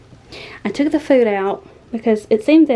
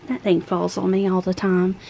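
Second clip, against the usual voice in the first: ordinary talk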